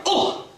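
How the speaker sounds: high, short cry of impact; acted yelp. Hawk is caught on the thorn, high mock yelp. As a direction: yelp as if suddenly stuck.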